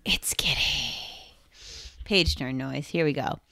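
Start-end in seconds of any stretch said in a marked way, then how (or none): 0.0-0.9 secret whispered guess. Real, not acted. Whispered guess about the clue.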